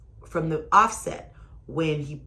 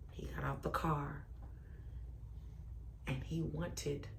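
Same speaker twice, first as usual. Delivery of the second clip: dramatic whisper; 2 semitones lower, 17 dB quieter than usual. Real, not acted.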